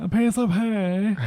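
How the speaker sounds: slow, high posh British vowels; posh English accent. Retold or imitated. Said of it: "posh accents".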